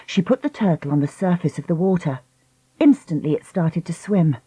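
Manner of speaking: plain reading voice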